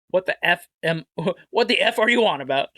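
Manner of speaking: a hint of Cockney through laughter; tossing off the Cockney line again while laughing; light Cockney accent. Host half-laughing a Cockney line at his chat. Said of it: "Cockney or British accent".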